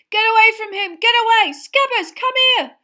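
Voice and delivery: high, loud yell; shouting. A narrator's reading. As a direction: yell after the rat. Ron shouts across the lawn.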